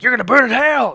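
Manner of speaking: shouted high and loud like a street preacher; street preacher yell. Retold or imitated. Vlogger mocking street preachers, loud preacher shout. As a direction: yell hellfire at a stranger. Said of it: "like a street preacher".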